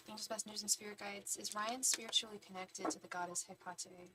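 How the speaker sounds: soft, repeated ritual chant; spirit invocation. Real, not acted. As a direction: murmur the same question to the spirits like an incantation.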